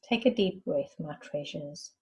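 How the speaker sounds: measured meditation guide, long silences between phrases; guided meditation. Acted. Guide asking listeners to breathe and rest into the space, calm meditation voice. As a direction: lead them inward in unhurried phrases, letting each one settle.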